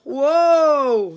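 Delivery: booming caller's bellow. A narrator's reading. Caller bellows at the quoted price, loud booming whoa. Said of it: "he bellowed".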